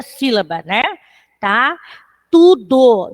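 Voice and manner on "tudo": TUDO alone, loud and deliberate; syllable demonstration. Shown as a demonstration. Teacher breaking down the verse for scansion, emphatic TUDO. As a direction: punch out TUDO as an isolated word. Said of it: "Nós temos aí tudo ao meu".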